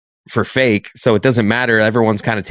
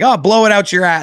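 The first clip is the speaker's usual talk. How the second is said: mock snappy comeback; 8 semitones higher than usual. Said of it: "her reaction to you was like".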